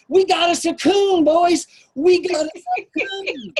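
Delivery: loud, high, excited yell; triumphant shout. Retold or imitated. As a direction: crow it out like he caught the prize. Scoutmaster shouting at boys.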